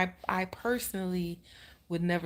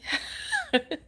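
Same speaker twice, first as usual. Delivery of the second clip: a sudden squealing laugh; high burst of laughter. Real, not acted. A high laugh remembering the oxygen mask. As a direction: break into a much higher laugh.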